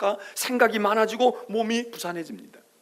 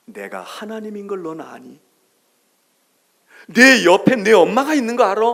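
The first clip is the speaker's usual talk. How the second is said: comforting father; 3 semitones higher, 8 dB louder than usual. Acted. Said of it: "그리고 하는 말이".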